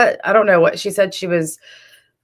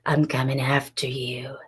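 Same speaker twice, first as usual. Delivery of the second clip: low breathy whisper, imitating; scary sexy voice; 5 semitones lower, 9 dB quieter than usual. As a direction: whisper it like a cheesy villain. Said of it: "The scary, sexy Amber voice".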